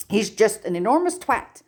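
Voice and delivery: understated angry growl; mild growl. A narrator's reading. Hermione lightly growls about Draco. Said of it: "Hermione all but growls".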